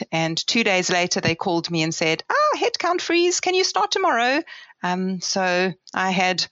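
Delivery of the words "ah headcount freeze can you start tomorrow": mock-upbeat caller, pitched much higher; imitating the caller. Recalling the caller who offered her the job, imitated much higher. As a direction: lightly act out the eager call offering the job. Retold call from the employer.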